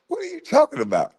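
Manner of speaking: high, fast disbelieving squawk; incredulous outburst. Real, not acted. Scoffing at the host's question, high fast incredulous outburst. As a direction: shoot up in pitch in disbelief.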